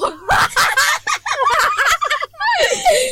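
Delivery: very high, loud shrieking laugh. Acted. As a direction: burst into a screeching laugh. Laughing in the middle of the quarrel.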